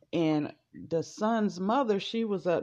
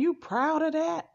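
high, sharp challenging question; mother challenging the rich family over slavery, high and sharp; 9 semitones higher than usual. Retold or imitated. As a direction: throw the question back at her. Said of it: "She saying".

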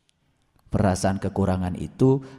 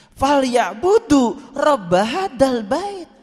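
high, emphatic spoken declamation; declaimed verse; 13 semitones higher, 4 dB louder, much slower than usual. Real, not acted. Preacher driving the verse home to congregation, emphatic. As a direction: proclaim the verse word by word like a command.

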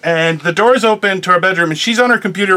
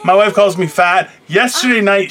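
ordinary talk, nothing marked

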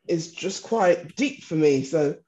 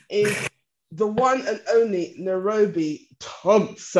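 slow, drawn-out guest reveal; announcer introduction. Real, not acted. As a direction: stretch each word like a show host's big introduction. Announces guest after imagined drum roll.

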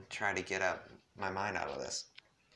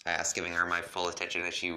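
ordinary delivery

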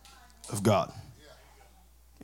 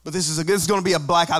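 ordinary talk

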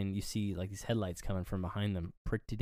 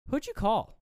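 tag-free retold question; 11 semitones higher than usual. Sydney warily questions Billy in retelling.

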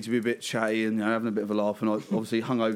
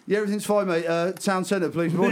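higher, louder fake breezy voice; forced cheer; 6 semitones higher, 4 dB louder than usual. Son covering a row for the taxi driver, forced cheer. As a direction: brush it off with false cheer. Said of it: "I was like".

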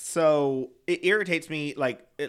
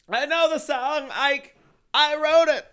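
high, louder, sassy comeback; imitating Raja; 13 semitones higher, 7 dB louder than usual. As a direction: fire back the line with diva sass. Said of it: "Raja when she goes".

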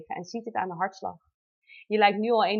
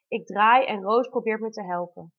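ordinary talk

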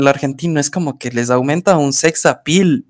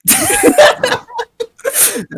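very high burst of laughter. Real, not acted. Laughing at the joke about Cuenca singing, high laugh.

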